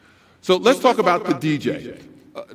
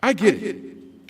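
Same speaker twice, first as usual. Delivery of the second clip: ordinary delivery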